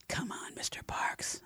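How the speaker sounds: slow whispered plea; inward pleading whisper. A narrator's reading. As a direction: beg him silently, under your breath. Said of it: "I pleaded inwardly".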